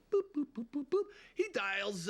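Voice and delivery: ordinary talk, nothing marked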